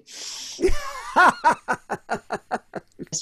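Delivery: high, long peal of laughter; loud laugh. Real, not acted. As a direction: burst out laughing at your own joke. Laughs at own punchline.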